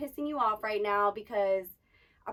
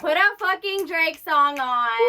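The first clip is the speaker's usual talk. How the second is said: loud, slow, higher hype call; hyped demand; 7 semitones higher, 13 dB louder, slower than usual. Acted. Dancer demanding [name] to get hyped, loud hype. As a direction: drag it out to get pumped. Hyping up with music.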